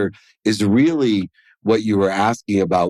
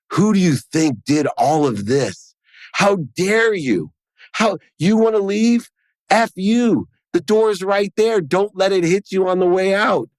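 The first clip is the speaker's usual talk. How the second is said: furious rant; 10 semitones higher than usual. Retold or imitated. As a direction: explode at her as she leaves. Furious rant at leaving wife.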